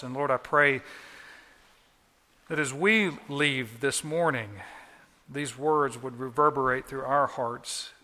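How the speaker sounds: earnest closing prayer to the Father; measured pulpit prayer; pastoral prayer. Acted. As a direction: pray sincerely over the congregation.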